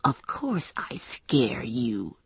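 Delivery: low, soft, drawn-out threat; quiet menace. Acted. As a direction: take your time intimidating them. Knife-wielding man menaces narrator.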